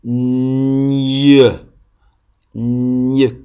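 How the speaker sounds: "Gne" said slowly with clear mouth shapes; gne sound. Shown as a demonstration. Teacher showing the class how to say "Gne", exaggerated mouth. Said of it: "regardez bien ma bouche".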